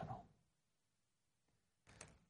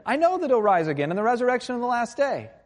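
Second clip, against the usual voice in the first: high, loud retort; insistent Martha; 11 semitones higher, 5 dB louder than usual. Retold or imitated. Sister answers Jesus's promise, insistent and high. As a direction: answer emphatically, pitched well up.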